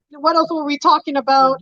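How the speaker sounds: ordinary talk, nothing marked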